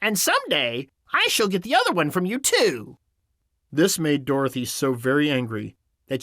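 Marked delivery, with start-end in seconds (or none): 0.0-3.0 high, gloating witch threat; wicked witch, gloating; mocking witch. A narrator's reading. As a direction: taunt her in a high witchy voice. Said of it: "laughing at her".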